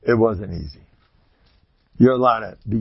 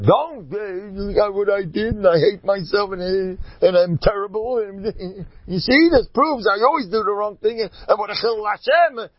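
high-pitched, acted-out self-reproach; high, pleading mock wail of guilt; distraught self-blame; 9 semitones higher than usual. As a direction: play a man beating himself up, voice pitched high. Acts out self-blaming grieving man.

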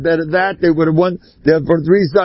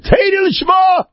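very high, ringing exclamation; emphatic quoted cry. Retold or imitated. Quoting Rabbi Aaron's answer on the school, high emphatic cry. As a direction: proclaim it high, imitating the rabbi. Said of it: "Rabbi Aaron said".

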